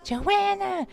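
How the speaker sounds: very high, loud calling out; imitating a teacher's shrill hunting call; teacher yelling. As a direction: shout a name across the room. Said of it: "She's over there yelling".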